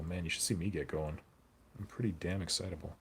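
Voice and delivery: normal talking voice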